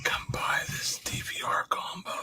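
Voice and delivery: eerie beckoning whisper. Acted. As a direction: whisper it like a ghostly call. Yard sales calling him.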